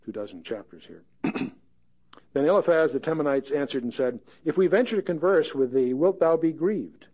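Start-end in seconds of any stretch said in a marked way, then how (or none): 2.3-7.0 scripture reading. Acted. Pastor reading Job aloud to the class, raised and measured. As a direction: read it out like a preacher.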